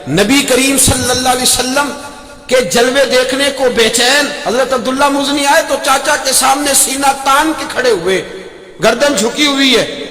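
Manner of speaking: high, ringing preacher's voice; fervent sermon delivery; impassioned preaching. Acted. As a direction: lift it up and sermonise. Preaching at full force.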